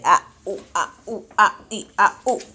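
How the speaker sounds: low sing-song oh ah ooh chant; monkey noises. Shown as a demonstration. Parent modelling monkey sounds for toddler, low and playful. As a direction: hoot like a monkey to make the baby copy.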